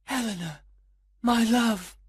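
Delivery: high, swelling romantic exclamation; lovestruck cry. A narrator's reading. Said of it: "he cried out".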